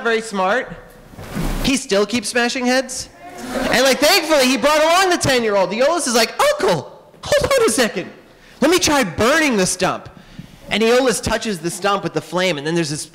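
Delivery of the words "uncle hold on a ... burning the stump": put-on much higher, eager child's voice; kid nephew voice. Nephew Aeolus stops his uncle, in a high kid voice. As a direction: pipe up like an excited ten-year-old. Ten-year-old nephew speaking.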